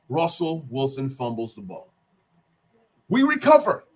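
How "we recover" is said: high, loud fan excitement; thrilled sports fan, pitched way up; excited outburst. Real, not acted. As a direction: burst out with the big news. Excited fan recounting the fumble recovery.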